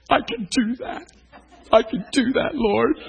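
high, slow, tear-choked yes; tearful. Retold or imitated. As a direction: laugh through the tears. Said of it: "tears all over my face".

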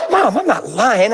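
lightly acted kid pleading innocence; drawn-out, feigned-innocent protest; protesting innocent child. Child lies to mother, acted.

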